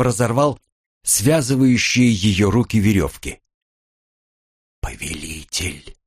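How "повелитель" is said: faint, low and drawn out; much softer, slow and low; barely audible whisper. A narrator's reading. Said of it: "еле слышно прошептала Элли".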